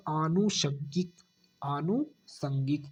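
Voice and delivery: आनुषंगिक drawn out slowly; slow model word. Shown as a demonstration. Teacher slowly dictating the correct word, very slow. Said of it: "आनुषंगिक कैसा होगा".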